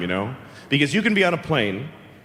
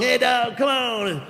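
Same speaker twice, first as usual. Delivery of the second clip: higher-pitched drawn-out call to a dog; dog owner's call; 6 semitones higher, slower than usual. Acted. Guy with a pickup calling his dog, drawn-out call. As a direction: call the dog over, stretching it out.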